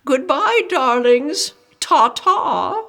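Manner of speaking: high, posh-sounding aunt's farewell; airy high-society goodbye; fancy proper aunt. Acted. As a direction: trill it like a fancy lady waving goodbye. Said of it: "She's fancy and proper".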